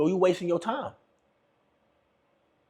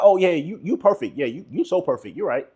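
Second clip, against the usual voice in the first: sarcastic. Acted. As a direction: hit them with dry sarcasm. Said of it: "hit them with that sarcasm".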